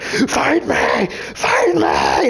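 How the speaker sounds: high-pitched, hammed-up taunt; mock fierce challenge. Witch goading a Jedi who won't fight, hammed-up challenge. Imitates the attacker's challenge, mocks acting.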